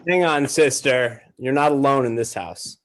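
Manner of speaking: ordinary talk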